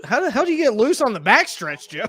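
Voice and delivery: high, loud cry of disbelief; exclaiming in dismay. Real, not acted. Fan yelling at the driver who got loose, high and loud. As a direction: holler it at the driver on screen.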